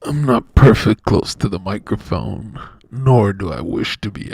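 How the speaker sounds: a pompous deep mock of a friend's line; mocking impression. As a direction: imitate him as pompous and grave. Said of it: "I use the word nor".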